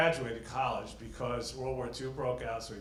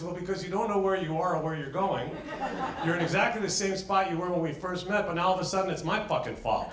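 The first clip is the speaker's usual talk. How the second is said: laughing punchline; 7 semitones higher, 5 dB louder than usual. Retold or imitated.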